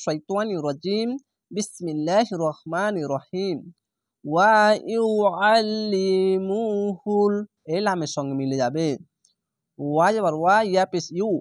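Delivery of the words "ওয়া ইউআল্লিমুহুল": slow, drawn-out chanted recitation; Quran recitation. Shown as a demonstration. Teacher to students, slow drawn recitation. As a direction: recite it slowly with tajweed. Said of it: "Quran recitation demo".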